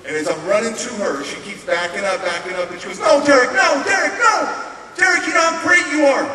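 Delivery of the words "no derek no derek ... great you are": high, loud frantic plea; pleading shout. Retold or imitated. Woman backing away from raging Derek, high pleading shout. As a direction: cry out to stop someone charging at you.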